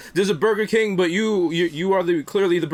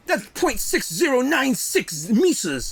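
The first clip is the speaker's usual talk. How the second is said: mock British accent; 5 semitones higher, slower than usual.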